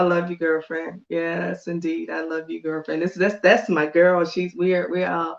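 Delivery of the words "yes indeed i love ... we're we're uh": normal talking voice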